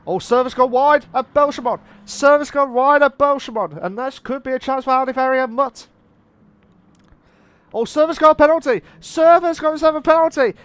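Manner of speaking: loud, high play-by-play alarm; excited race call. Real, not acted. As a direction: shout the incident as it happens. Commentator calls a sudden race incident.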